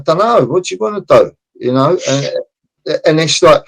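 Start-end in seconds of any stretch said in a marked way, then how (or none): 0.0-1.3 quick, high, careless shrug of a builder; shrugging builder. Retold or imitated. Builder shrugging at the stuck client, quick and careless. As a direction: toss it off like a builder who doesn't care. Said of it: "builders just stand there going".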